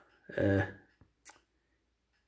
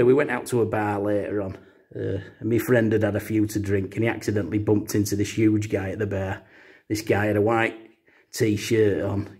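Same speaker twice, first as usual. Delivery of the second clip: ordinary talk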